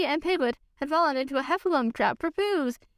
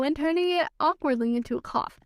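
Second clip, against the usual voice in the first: plain reading voice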